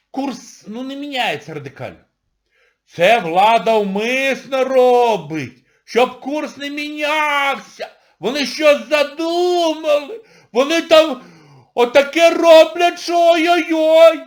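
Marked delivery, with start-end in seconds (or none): 2.9-14.2 slow, loud, much higher ominous voice; mock conspiracy theorist. Parodying people who suspect the government, loud and slow. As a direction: ham up a paranoid citizen. Mimicking paranoid citizens on exchange rate.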